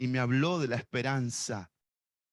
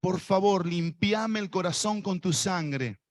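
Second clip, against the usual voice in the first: led prayer; 2 semitones higher than usual. Shown as a demonstration. Preacher leading the congregation in prayer, fervent.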